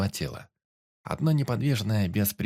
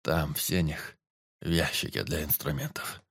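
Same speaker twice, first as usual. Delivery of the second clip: weak forester; 5 semitones lower than usual. A narrator's reading. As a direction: ask as if barely able to see him. Dying forester voiced.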